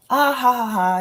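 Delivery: loud, high "ah ha ha"; teasing mock laugh. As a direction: re-enact a playful taunt from hiding. Re-enacting teasing cousin from hiding place.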